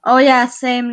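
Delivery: normal talking voice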